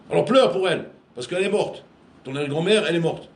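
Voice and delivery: plus fort et plus aigu, ton d'ordre; ordre sévère imité. Retold or imitated. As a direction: imite l'adulte qui exige des larmes. Ordre de pleurer, voix forte. (ordre sévère imité, plus fort et plus aigu)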